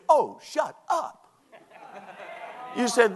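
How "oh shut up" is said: high, slow comic put-down; exasperated dismissal. Shown as a demonstration. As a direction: stretch out each word to silence a gossip. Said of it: "take my very words and say".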